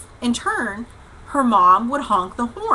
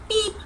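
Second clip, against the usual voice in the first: imitated horn beep; 12 semitones higher than usual. Driver retelling honking round every curve, imitated horn beep. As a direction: mimic a little car horn. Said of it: "honking the horn".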